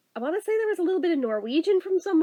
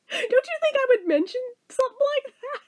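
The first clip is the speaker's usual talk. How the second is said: high exasperated plea; 9 semitones higher than usual. Real, not acted. Exasperated at friends.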